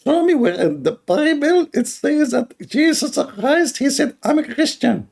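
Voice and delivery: high, slow put-on caller voice; mock high voice. Quoting the caller's impossible question in a high mock voice. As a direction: squeak the question out slowly. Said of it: "he says to me".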